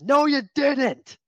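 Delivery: high, loud, drawn-out heckle; imitated heckler's yell. Lone booer contradicting the athletic director, yelling high. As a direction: holler it slowly in protest. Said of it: "it's just him yelling".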